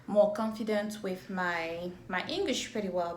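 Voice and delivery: ordinary delivery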